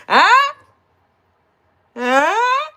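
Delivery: high, loud questioning 'huh'; imitated hard-of-hearing father. Old father in the back not hearing, imitated loud 'huh'. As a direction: yelp it as if you can't hear.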